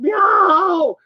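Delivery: high loud yowl; desperate meow. Retold or imitated. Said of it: "getting more and more desperate".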